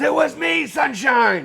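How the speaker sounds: put-on high shouted villain reveal in British accent; diabolical William Regal. Regal confesses to Triple H, diabolical British shout. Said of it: "Regal looks up with that diabolical smile".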